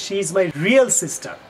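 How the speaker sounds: slow wrong example. Shown as a demonstration.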